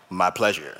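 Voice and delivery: deep-voiced waiter. Pastor mimics waiter answering his wife in a deep voice. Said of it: "a taller guy with a deep voice".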